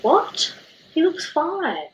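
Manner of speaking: high, loud disbelieving question; surprised. Retold or imitated. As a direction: protest in shock. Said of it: "And I was like".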